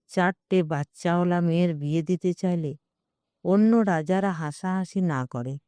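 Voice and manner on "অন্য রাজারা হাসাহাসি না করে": ordinary delivery, nothing marked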